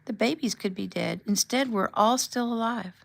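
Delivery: a wife in hospital sobbing out her relief to her husband; crying. Acted. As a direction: let the tears break through as she speaks. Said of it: "His words started the fountain of tears".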